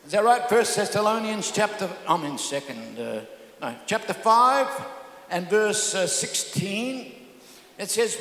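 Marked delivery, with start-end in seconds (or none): none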